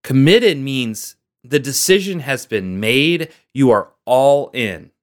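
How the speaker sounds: "Committed" slow and firm, lines drawn out; emphasis on committed. Shown as a demonstration. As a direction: lean hard on "Committed", slowly. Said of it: "I wanna emphasize the word committed".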